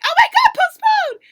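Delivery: very high, loud laughing squeal; imitating a shriek. Teasing a friend over her enlistment reaction, mock shriek. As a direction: mimic her hysterical group chat reaction. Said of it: "she's just like".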